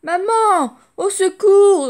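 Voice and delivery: very high, loud panicked shout for mum; loud shrieking cry for help; terrified scream. Acted. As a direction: scream for help while dangling. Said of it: "hurle le méchant".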